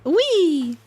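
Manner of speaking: gleeful whee. Real, not acted. Gleeful cry while riding.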